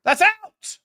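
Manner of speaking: high, loud appeal to the referee; shouting at the ref. Real, not acted. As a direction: yell it up at the ref. Shouting at ref during match.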